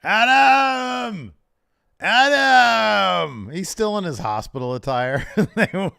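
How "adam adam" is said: high, loud, drawn-out cry for Adam; mock wailing call. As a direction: wail the name like a melodramatic plea. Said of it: "what do we hear, Steve?".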